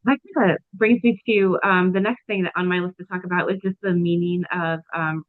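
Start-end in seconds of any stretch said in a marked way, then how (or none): none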